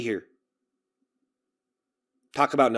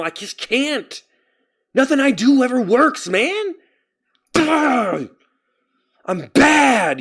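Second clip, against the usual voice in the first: high-pitched frustrated outburst; exasperated wail; 11 semitones higher, 4 dB louder, much slower than usual. Real, not acted. Streamer venting that nothing works, exasperated wail. Venting about his failing videos.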